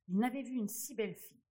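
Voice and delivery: usual speaking voice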